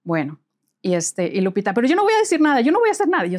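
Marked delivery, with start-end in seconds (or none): 1.7-3.3 frightened protest. Retold or imitated. Lupita panicky refusal.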